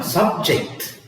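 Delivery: subject as a noun, SUB-ject; stress on first syllable. Shown as a demonstration. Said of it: "stress on the first syllable".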